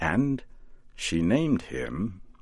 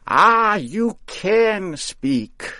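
high, slow, louder exclaim; delighted witch; 13 semitones higher, 6 dB louder, much slower than usual. A narrator's reading. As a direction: marvel at him like a proud witch. Witch answers scarecrow.

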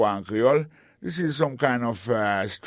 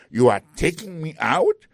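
indignant surprise; 5 semitones higher, 5 dB louder than usual. Acted. Indignant about being thrown out.

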